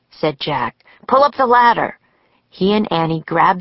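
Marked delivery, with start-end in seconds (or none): 1.1-2.0 louder, higher command; urgent order. A narrator's reading. Jack shutting out the climbing ninjas, urgent order. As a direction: call it out in a hurry.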